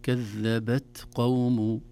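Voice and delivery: "كذبت" with a breathy released ت before "قوم"; whispered t. Shown as a demonstration. Teacher showing the breathy ت of "كذبت", slow. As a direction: let air escape on the ت of "كذبت". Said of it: "الهمس في حرف التاء الساكنة".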